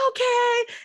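very high-pitched fangirl okay; imitated thrilled agreement. Daughter told the actor wants to meet her, imitated giddy squeal.

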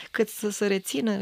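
usual speaking voice

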